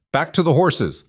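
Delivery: rally the others to flee; shout. A narrator's reading. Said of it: "one of them shouted".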